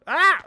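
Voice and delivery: sharp high yelp; sudden yelp of pain. Real, not acted. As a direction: cry out as you get hurt.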